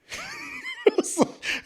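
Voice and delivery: high, surprised laugh; laugh, caught off guard; real burst of laughter. Real, not acted.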